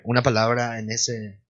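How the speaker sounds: normal talking voice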